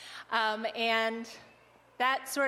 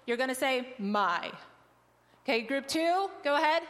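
usual speaking voice

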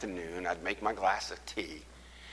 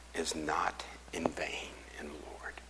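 hushed; 3 semitones lower, 9 dB quieter than usual. Real, not acted. Scripture read in whisper.

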